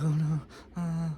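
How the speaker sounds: soft, high mock worry; quiet retold dismay. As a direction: imitate a scared patient going small. Patient reacts to infection news.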